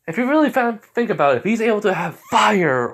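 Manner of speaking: real excitement defending the plot twist; excited. Real, not acted. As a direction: fire it off like you can't wait to explain. Excitedly justifying his story choice.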